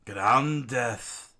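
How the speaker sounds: loud villain attack shout; screamed attack name. Acted. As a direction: yell out the move name. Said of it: "he screamed out".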